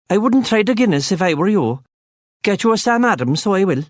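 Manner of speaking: high Cork lilt; young barman, high and friendly. A narrator's reading. Barman recognises the accent, high Cork lilt.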